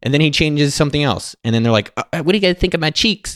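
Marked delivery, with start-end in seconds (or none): 2.0-3.3 high, put-on pop star voice; high-pitched celebrity impression. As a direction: imitate a star fishing for approval. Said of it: "[name] said to his guys".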